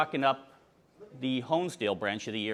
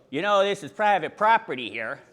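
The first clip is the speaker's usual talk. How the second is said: louder, higher imitation of a girl objecting; mock scolding; 6 semitones higher, 8 dB louder than usual. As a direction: lightly voice a girl warning off a trespasser. Girl challenges a trespasser.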